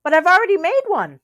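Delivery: high, fast cheerful reply; Bear's cheery call. A narrator's reading. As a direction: toss it back brightly. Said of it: "shouted Bear".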